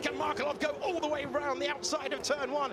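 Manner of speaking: excited. Real, not acted. Tense overtaking move.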